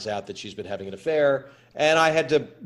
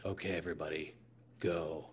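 Clint Eastwood's low call; 8 semitones lower, 15 dB quieter than usual. Retold or imitated. Quoting Clint's quiet start on set.

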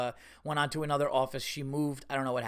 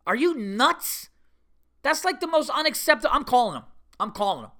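high-pitched indignant outburst; outraged; 8 semitones higher, 3 dB louder than usual. Real, not acted. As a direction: fume in disbelief, voice shooting up. Outraged, decides to call them.

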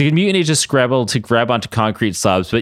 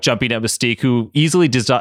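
ordinary delivery

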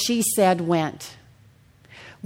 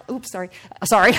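laughing apology; 2 semitones higher, faster than usual. Real, not acted.

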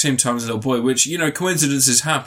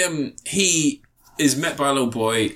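ordinary talk, nothing marked

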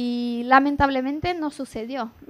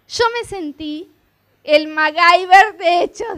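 excited; 4 semitones higher, 10 dB louder, slower than usual. Real, not acted. Testimony climax, proud exclamation.

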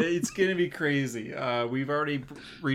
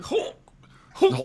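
mock retch. Mocking vomit-like Hulk call.

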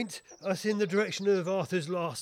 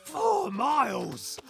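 high, loud, slow exclamation; outraged cry; 9 semitones higher, 5 dB louder, much slower than usual. Acted. Inspector protests running after the coach, outraged and loud. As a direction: wail the distance in exhausted outrage.

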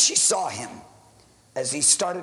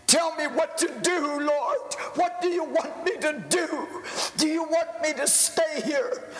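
raised, crying out to God in anguish; a desperate pastor begging the Lord at night; pleading prayer; 5 semitones higher than usual. Acted. Pastor alone in his church, pleading prayer to God.

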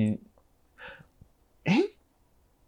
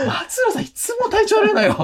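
much higher, gossiping mom voice; mother's gossip voice; 3 dB louder than usual. Retold or imitated. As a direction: mimic mom gossiping about a coworker. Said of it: "っつって". (母親のうわさ話の高い声)

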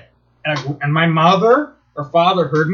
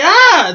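high, loud frustrated ah; frustrated yell; 12 semitones higher, 6 dB louder than usual. Retold or imitated. Annoyed at the operator, yelling ah. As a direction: shout it out in annoyance. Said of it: "And I'm like".

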